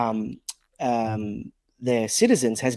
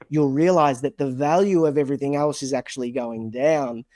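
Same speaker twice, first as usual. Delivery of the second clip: usual speaking voice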